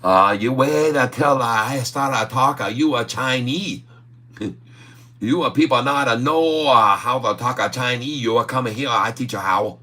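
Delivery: lightly hammed mock Chinese accent. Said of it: "How in the world I speak a Chinese".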